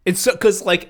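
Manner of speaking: normal talking voice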